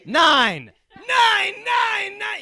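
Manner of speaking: high, loud barked nein; ranting shouted nein. Acted. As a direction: bark it like a German no. Hitler joke, German nein.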